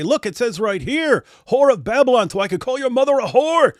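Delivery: high, loud mock ranting; hammed-up, high-pitched accusatory tirade; Sam. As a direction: send up a ranter quoting scripture at full pitch. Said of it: "And so he'll say".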